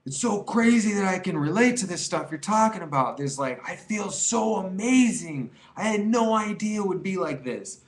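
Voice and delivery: high, gushing quote of a happy emailer; lightly voiced grateful fan; excited emailer. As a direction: sound thrilled and amazed. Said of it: "They're all like".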